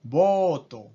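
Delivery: voto as bo-to, two flat syllables; Japanese loanword. Shown as a demonstration. Teacher modelling the Japanese form: ボート. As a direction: turn boat into boto. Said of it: "boat se convierte en voto".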